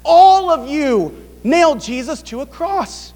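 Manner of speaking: pointing at the whole crowd; emphatic quote. Retold or imitated. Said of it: "No, he's saying".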